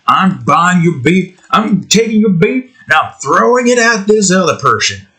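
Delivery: high-pitched, hammed-up indignation; mock defiance. Republican buying beer to throw at someone, mock defiance. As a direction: parody a riled-up beer buyer.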